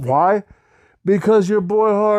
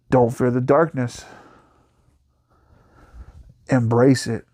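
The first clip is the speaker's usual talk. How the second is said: ominous sign-off; 4 semitones lower than usual. Acted. Host to his horde, low and ominous. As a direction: drop low, let it linger.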